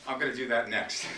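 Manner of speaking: normal talking voice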